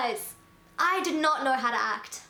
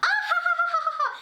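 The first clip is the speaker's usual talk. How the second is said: very high mock cackle; imitated hysterical laughing; 4 dB louder, much faster than usual. Date laughs at his own fake name, imitated high cackle. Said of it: "starts laughing his freaking head off".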